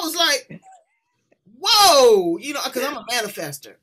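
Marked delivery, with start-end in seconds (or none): 1.6-2.4 a much louder, amazed shout; amazed whoa. Retold or imitated. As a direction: let the wonder burst out loud. Reacting to answered prayer.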